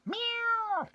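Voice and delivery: a playful high-pitched meow. Acted. Cooing at the radiant woman with a high meow.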